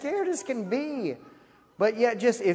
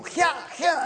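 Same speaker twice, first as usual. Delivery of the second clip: preacher's shout; 6 semitones higher than usual. Retold or imitated. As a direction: bark it like a preacher pushing people down. Minister laying hands on people.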